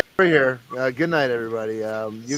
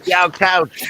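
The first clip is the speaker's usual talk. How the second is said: louder, higher imitated catchphrase; Dave Chappelle; 5 semitones higher, 6 dB louder, slower than usual. Host echoing his co-host's quote, louder imitated voice. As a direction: belt out a mock sketch curse. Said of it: "as played by Dave Chappelle".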